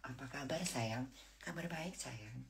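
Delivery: very soft, slow sweet-talk; hushed imitation of a doting couple; tender retold greeting. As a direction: murmur it lovingly, drawn out. Acts out tender greeting with husband.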